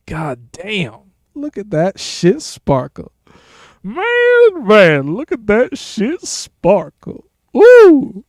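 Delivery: high, swooping put-on marvelling; mock awe. Streamer admiring the sparkle on the Warframe, hammed-up high awe.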